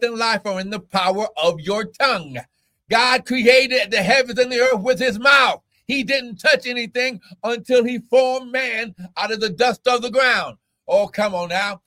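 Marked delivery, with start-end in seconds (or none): 2.9-10.6 full-force preaching. Real, not acted. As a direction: drive it home to the church.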